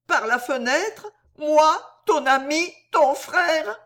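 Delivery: gémissement aigu et plaintif; larmoyant. A narrator's reading. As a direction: pleurniche comme un ivrogne blessé. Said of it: "larmoya Noé". (voix larmoyante et aiguë)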